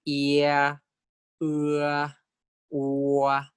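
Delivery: diphthongs said slowly, both vowels held evenly; Thai diphthongs. Shown as a demonstration. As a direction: model each diphthong with even length. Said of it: "And the diphthongs".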